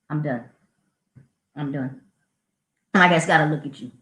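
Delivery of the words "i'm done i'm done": low, soft giving up; defeated. Real, not acted. Teacher tells listener she gave up, low and soft. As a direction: sink into defeat.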